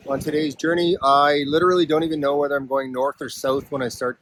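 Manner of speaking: loud and high over wind; shouting over wind. Real, not acted. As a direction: call out over gusting wind. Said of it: "can even hear me over the wind noise".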